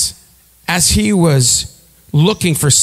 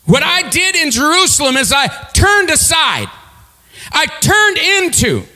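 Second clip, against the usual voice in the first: higher, ringing shouted preaching; full-force preaching; 13 semitones higher than usual. Acted. Preacher exhorting congregation to turn aside, full-force preaching. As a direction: drive the point home to the crowd.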